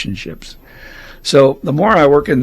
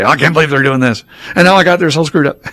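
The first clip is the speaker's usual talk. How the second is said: higher, louder retold complaint; mock-exasperated griping, pitched up and loud; exasperated venting; 5 semitones higher, 6 dB louder, faster than usual. Newcomer bringing a problem to his old sponsor, exasperated venting.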